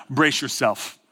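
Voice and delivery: low, ominous warning; God's voice. Acted. Preacher imitates God warning him, low and stern. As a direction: drop the pitch for a stern command. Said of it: "I don't want him to ever be like".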